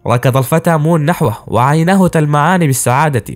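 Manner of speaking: ordinary delivery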